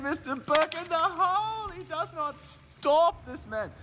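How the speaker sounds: ecstatic call. Pretend. Announcer ecstatic at another hole in one. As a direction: soar up on the call, slow.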